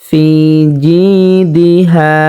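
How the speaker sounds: slow recited ফি জিদিহা with long vowels; one-count elongation. Shown as a demonstration. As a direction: stretch ফি, জি and হা one beat each. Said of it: "এক আলিফ পরিমাণ টানিয়ে পড়তে হবে".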